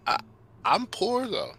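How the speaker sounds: high, halting, questioning voice; falter, then ask in a high puzzled voice; bewildered kid. Acted. Midas asking whether his parents are royalty, bewildered.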